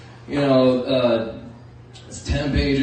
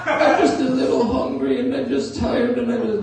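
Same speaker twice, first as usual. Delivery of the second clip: high-pitched pitiful bear; silly high bear impression; Pooh Bear; 10 semitones higher than usual. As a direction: plead like a tired, hungry cartoon bear. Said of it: "that Pooh Bear impression".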